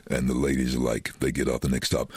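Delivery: normal talking voice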